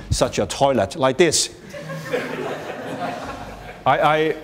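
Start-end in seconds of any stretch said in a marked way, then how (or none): 1.7-3.8 breathy burst of real laughter at the picture; amused laugh. Real, not acted. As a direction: let a real laugh escape. Laughs at a toilet photo.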